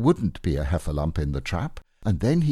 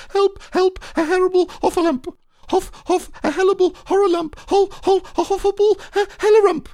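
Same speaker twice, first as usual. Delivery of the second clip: very high, fast, garbled panic shriek; shriek, tripping over the words; terrified crying out; 7 dB louder, faster than usual. Acted. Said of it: "still crying out".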